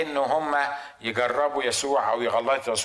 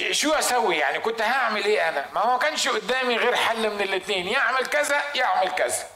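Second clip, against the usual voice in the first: higher whiny excuse with laughing; preacher mocking a listener's excuse, higher, laughing; mock self-excuse; 5 semitones higher than usual. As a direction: mimic someone justifying themselves. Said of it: "وبعدين تقول".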